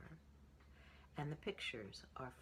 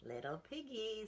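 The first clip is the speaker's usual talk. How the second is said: higher teasing voice; brother pig; 8 semitones higher than usual. A narrator's reading. Brother scolding Piggy, higher teasing voice. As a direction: taunt him a bit. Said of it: "said the brother".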